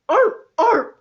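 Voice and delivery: loud high arr arr seal bark. Shown as a demonstration. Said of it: "More like".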